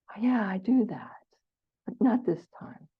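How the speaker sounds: whisper. Pretend. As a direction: kindly whisper it to yourself. Gentle self-talk, whispered.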